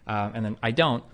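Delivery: ordinary talk, nothing marked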